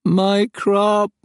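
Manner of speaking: high, loud alien moan; moaning creature. Acted. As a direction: mourn the crop like a hurt beast. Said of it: "it moaned quietly".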